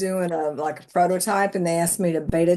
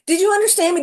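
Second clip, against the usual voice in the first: mimicking pushy AI; 10 semitones higher, 5 dB louder, much faster than usual. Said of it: "it would break in and say".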